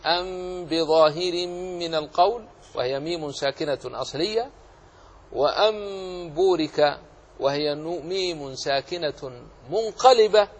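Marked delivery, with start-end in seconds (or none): 0.1-2.5 slow recitation, أن بظاهر with a held m before b; same hidden-meem sound. Shown as a demonstration. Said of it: "فيستوي في نطق". 5.3-7.0 وأن بورك said as wa-am-būrika; slow recited وأن بورك, n turned to m; converted noon as meem. Shown as a demonstration. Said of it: "فيستوي في نطق".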